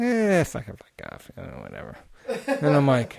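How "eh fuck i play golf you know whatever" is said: imitated guy shrugging off the question; dismissive grumble. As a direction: brush it off, trailing away very quietly. Imitating the reluctant new acquaintance.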